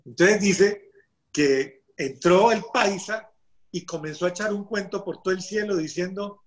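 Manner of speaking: plain reading voice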